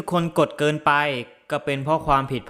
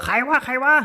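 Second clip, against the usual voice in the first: uncle hollering at the intruder, a mock yell; angry outcry; 8 semitones higher than usual. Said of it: "โวยวายว่า".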